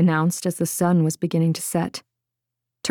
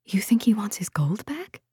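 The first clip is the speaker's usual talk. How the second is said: whispering; 3 semitones higher, 4 dB quieter than usual. A narrator's reading. Secret talk about a traitor.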